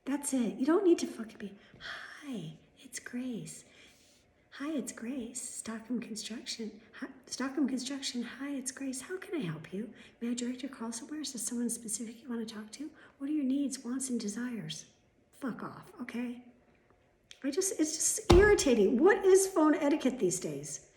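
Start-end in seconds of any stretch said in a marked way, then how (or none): 1.8-14.9 overdone, higher receptionist sing-song; sickly helpful, mocking phone greeting; mock phone-answering voice. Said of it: "Nobody fucking talks like that". 15.4-20.9 loud, high exasperation; real irritation, worked up. Real, not acted. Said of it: "can you see how worked up I am".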